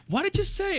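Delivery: high-pitched mock mom, demanding; mock angry mom. Mom confronting her son after he swore, high mock voice. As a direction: squeal it like an outraged mother. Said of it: "so she went".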